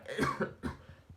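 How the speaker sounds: choking cough; harsh choking coughs; coughing fit. Real, not acted. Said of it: "I'm choking here".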